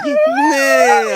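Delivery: very high squeak at the pet. Real, not acted. Baby talk to pet.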